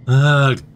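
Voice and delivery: loud, exasperated groan; frustrated groan. Acted. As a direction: let out a fed-up groan. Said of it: "Tange groans".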